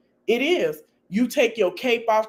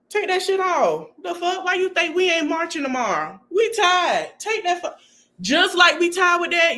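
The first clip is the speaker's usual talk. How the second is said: exasperated urging; 9 semitones higher than usual. Real, not acted. Urging a friend to quit.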